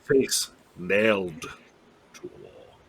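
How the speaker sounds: "face... nailed... to a wall", slow with gaps; slow clarification. Real, not acted. Player repeats misheard words for another player, slow clarification. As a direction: repeat it slowly, word by word, to be understood. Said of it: "I don't know why I heard".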